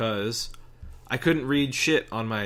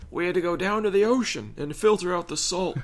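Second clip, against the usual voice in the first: put-on old man voice; 6 semitones higher than usual. Friend one-ups the others about having no well, old man voice. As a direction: play an old man boasting of hardship. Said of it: "I switched my old man voice".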